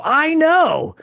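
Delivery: high, loud eager agreement. Retold or imitated. Nervous fan agreeing with a tough guy. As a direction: agree fast to stay safe. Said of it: "I go".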